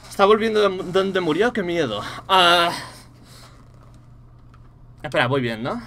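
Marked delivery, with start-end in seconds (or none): 2.3-2.7 short loud gasp of fright; startled gasp. Real, not acted. Gamer gasps at a scare, loud startled ah.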